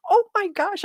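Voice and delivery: high laughing squeal. Real, not acted. Reacting to the comment with a laughing squeal.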